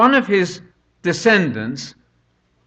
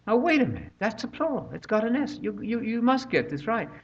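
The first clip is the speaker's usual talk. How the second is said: higher, softer pedantic objection; mock browbeating; 6 semitones higher, 6 dB quieter than usual. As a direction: play-act a fussy corrector picking at grammar. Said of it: "if I were to browbeat you".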